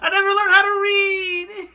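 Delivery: high, loud, hammed-up sob voice; badly faked crying; mock crying. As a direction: wail it like a pretend crybaby. Mock crying replay of line.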